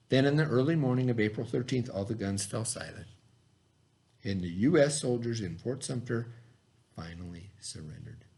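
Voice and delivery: measured read-aloud to a class. Acted. Teacher reading the Fort Sumter story to the class, read-aloud. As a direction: read the text clearly to young students. Reads history text to class.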